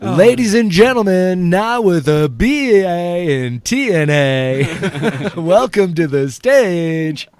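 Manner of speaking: club announcer. Pretend. MC welcomes a dancer to the stage, hammed-up announcer. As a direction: belt out a hammed-up stage introduction.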